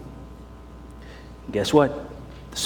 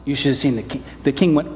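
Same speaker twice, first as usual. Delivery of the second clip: ordinary delivery, nothing marked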